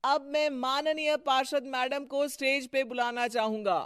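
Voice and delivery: high, loud emcee voice; stage announcement. Acted. Emcee inviting councillor onto stage, formal announcement. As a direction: announce the honoured speaker to the hall.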